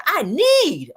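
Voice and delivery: punched-up, insistent "need"; emphatic, capital letters. Retold or imitated. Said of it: "in capital letters".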